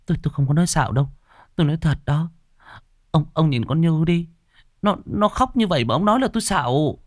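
high, stammering protest; annoyed and scared. A narrator's reading. Ánh insists to Vinh she isn't lying, annoyed and scared. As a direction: insist in a high, frightened, indignant voice. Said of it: "Ánh có bực bội, xen lẫn sợ hãi".

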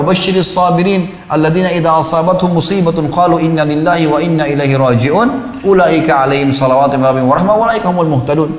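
rapid, even Arabic recitation; Quran recitation. Acted. Preacher quoting the Quran to listeners, rapid recitation. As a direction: recite the verse quickly from memory.

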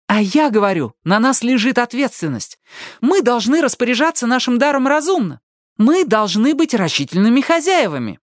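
high, heated, preachy insistence; riled. A narrator's reading. Ali lectures the smirking leader on responsibility, riled. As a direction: lay down the law, voice pitched high. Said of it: "вывела Али из себя".

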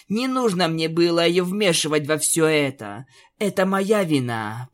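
ordinary delivery

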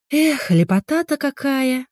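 slow, savouring delight; contented sigh. Acted. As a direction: relish the view slowly. Stretching, admiring the garden.

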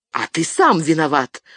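ordinary delivery, nothing marked

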